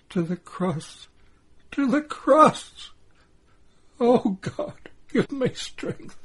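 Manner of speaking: high-pitched, breaking cry of grief; acted maternal anguish, pitched high; anguished despair. A narrator's reading. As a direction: cry out to God in agony. Mary despairs over her condemned son.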